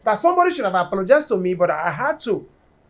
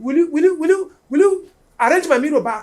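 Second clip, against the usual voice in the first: retold angry demand; 6 semitones higher, 8 dB louder, faster than usual. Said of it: "he started shouting".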